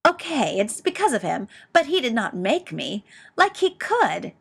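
high, indignant protest; outraged, defensive outburst. A narrator's reading. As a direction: argue back, pitch jumping with indignation.

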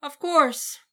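high, loud disbelieving echo; incredulous. Acted. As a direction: repeat it, unable to believe it. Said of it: "Victor echoed incredulously".

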